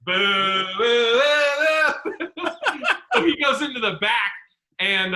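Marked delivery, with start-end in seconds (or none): 0.1-2.5 drawn-out imitated boos; crowd booing. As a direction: boo like a heckling audience. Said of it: "you just start hearing".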